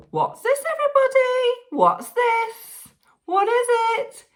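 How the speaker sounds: high-pitched, loud show-off voice; playful high sing-out questions; excited show-and-tell. Pretend. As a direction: squeal it to the audience like a big reveal. Showing off a toy to viewers.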